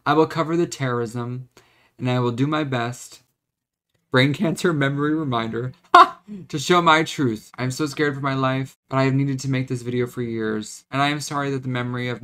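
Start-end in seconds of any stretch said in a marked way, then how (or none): 5.9-6.4 sudden high, loud laugh burst; a startled laugh at himself; short laugh. Real, not acted. Laughing at his own memory lapse, sudden and loud.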